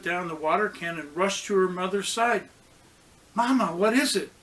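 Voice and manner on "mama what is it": worried question. A narrator's reading. Worried daughter asks mother.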